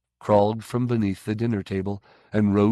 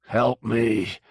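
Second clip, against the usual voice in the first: eerie plea; slower than usual. A narrator's reading. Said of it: "said Christophorus from the darkness".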